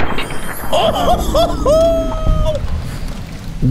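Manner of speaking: big high screaming laugh. Real, not acted. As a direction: burst into a shrieking cackle. Laughs after game surprise.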